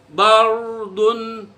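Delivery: bardun with a heavy rolled r; Quranic example word. Shown as a demonstration. Teacher demonstrating the heavy r, bardun. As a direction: say bardun with a thick, full r. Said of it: "Seperti contoh".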